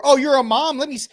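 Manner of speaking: high, loud simpering mock voice. Congressman caving to a mom, mock simpering.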